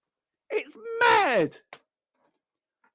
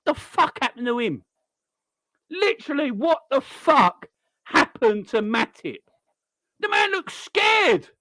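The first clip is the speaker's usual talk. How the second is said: ordinary talk, nothing marked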